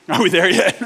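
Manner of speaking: mock kid's whine through a laugh; a dad laughing as he quotes his kids; laughing question. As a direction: ask it while cracking up. Kids' question again, laughing.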